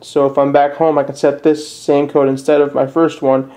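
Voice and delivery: usual speaking voice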